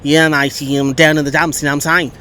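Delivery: a loose, badly faked Australian accent; awful Aussie accent. Fan picturing the downcast manager, awful Aussie accent. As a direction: mumble like a downcast Aussie manager, lightly hammed. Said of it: "That's my awful, awful Aussie accent".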